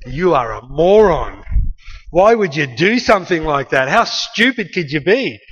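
loud, drawn-out mock berating; scornful scolding. Older brother berating younger over the car, scornful. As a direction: hit each word hard with exasperated scorn.

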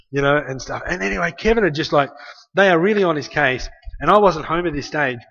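ordinary talk

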